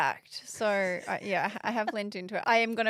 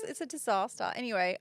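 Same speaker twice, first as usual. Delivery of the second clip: usual speaking voice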